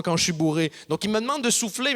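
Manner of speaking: ordinary delivery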